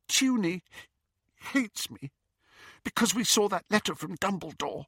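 high, halting, constricted upset; tearful. A narrator's reading. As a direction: break it up as if holding back tears. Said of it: "She had been crying".

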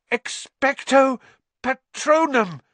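high, loud, effortful gasp. A narrator's reading. Harry casting at a nearing Dementor, gasped. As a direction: force out the spell in two bursts. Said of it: "he gasped".

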